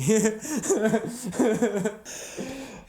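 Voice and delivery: high burst of laughter; big high laugh; loud laugh. Real, not acted. Loud laugh after joke.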